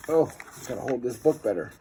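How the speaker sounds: ordinary delivery, nothing marked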